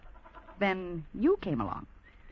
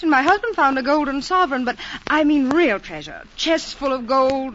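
high, loud, thrilled voice; eager excitement; 8 semitones higher, 10 dB louder than usual. Acted. Tourist woman asking Clive about treasure, eager excitement. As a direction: press eagerly for the real riches.

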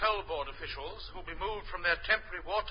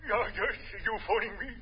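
put-on old stockbroker voice; 3 semitones higher, 6 dB quieter, much slower than usual. Stockbroker character voice.

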